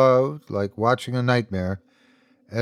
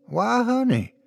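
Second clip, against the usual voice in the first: high, sugary Louisiana drawl; 10 semitones higher than usual. A narrator's reading. Ethan answering frightened Laura, high Louisiana drawl. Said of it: "his old Louisiana drawl".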